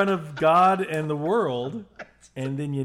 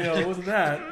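ordinary talk, nothing marked

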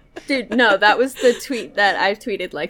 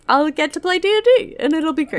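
excited; 8 semitones higher, 4 dB louder, faster than usual. Real, not acted. Excited about playing D and D.

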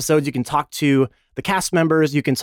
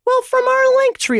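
excited; 4 dB louder than usual. Real, not acted. Answering mock question brightly.

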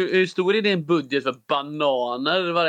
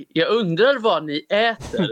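slow, higher-pitched drawn-out clip impression; AI old-man voice; 5 semitones higher, slower than usual. Co-host imitates the kid from a known clip, slow AI old-man voice. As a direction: imitate a stilted AI old man, stretching the words. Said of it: "låter som en A I-gubbe".

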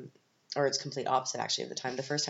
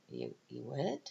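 doubtful; 6 dB quieter than usual. Retold or imitated. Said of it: "And I was like".

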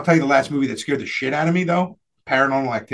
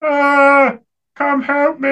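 higher, strained mock human voice; creepy bear voice; 8 semitones higher, 3 dB louder, much slower than usual. Imitating the movie bear calling the girl for help. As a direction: play the bear luring a girl, hammed up. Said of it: "it's going like this".

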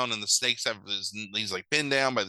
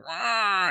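mock big-cat roar, higher; leopard roar; 9 semitones higher than usual. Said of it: "Proxima's like".

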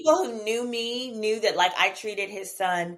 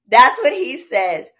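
ordinary talk, nothing marked

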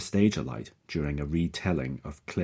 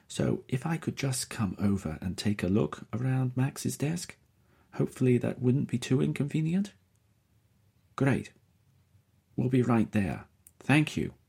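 higher, polite woman on the phone; Faiza's voice; 8 semitones higher than usual. A narrator's reading. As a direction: lift the voice for Faiza, courteous.

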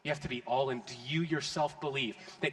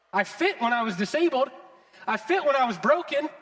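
loud, high preaching in the healed man's voice; impassioned first-person sermon cry; full-force preaching; 6 semitones higher, 5 dB louder than usual. Acted. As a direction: preach it out as the man who was healed.

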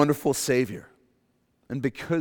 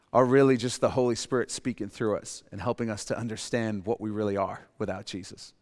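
ordinary delivery

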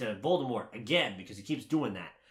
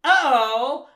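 loud, high, drawn "oh"; hammed-up group gasp of alarm; mock startled cry; 10 semitones higher, 13 dB louder than usual. Imitating everyone caught by the werewolf, startled cry. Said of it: "And everyone's like".

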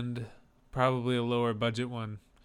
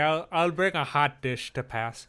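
a light hammed-up Minnesotan accent; a hint of a Minnesota accent, jokey; mock Minnesotan accent. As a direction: answer the Southern teasing in a folksy Minnesotan accent. Mock Midwestern reply to accent tease.

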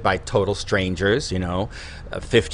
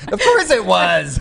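mock insult, laughing; 8 semitones higher, 6 dB louder than usual. Joking it was an insult.

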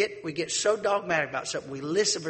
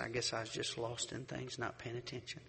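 subdued grief; 3 semitones lower, 9 dB quieter than usual. Retold or imitated. Grieving widower apologizes.

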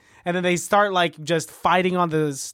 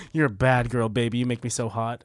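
mock dirty talk; 7 semitones lower than usual. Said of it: "he says".

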